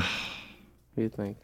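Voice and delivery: soft, slow whisper. Real, not acted. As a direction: lean in and whisper the question. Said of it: "Why are you whispering?".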